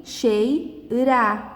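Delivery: "cheira" in two distinct beats, chei, ra; sílabas separadas. Shown as a demonstration. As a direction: pause inside "cheira" before ra. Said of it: "dessa sílaba aqui". ("cheira" dividido em sílabas)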